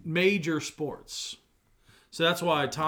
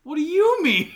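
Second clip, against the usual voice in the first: loud, high-pitched incredulous comeback; shrieked disbelief; indignant outburst; 14 semitones higher, 8 dB louder than usual. Real, not acted. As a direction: throw "you" back at them, high and loud. Indignant comeback in sports argument.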